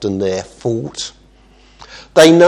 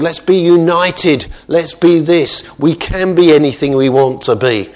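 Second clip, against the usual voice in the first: lifted, louder mock rallying cry; rallying crowd voice; 6 semitones higher, 6 dB louder than usual. Preacher voicing the builders of Babel, mock rallying. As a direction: lightly voice people cheering each other on.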